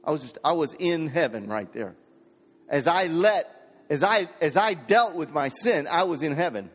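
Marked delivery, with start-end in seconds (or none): 2.7-6.7 high, loud preacher's proclamation; fiery preaching. Acted. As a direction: preach it out to the congregation. Preaching at full force.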